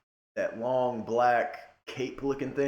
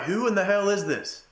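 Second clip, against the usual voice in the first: much higher, louder baffled outcry; baffled crowd; 9 semitones higher, 4 dB louder than usual. Retold or imitated. Crowd seeing a nobody leave the crate, loud baffled shout. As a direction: crowd stunned as a stranger walks out. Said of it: "everybody's like".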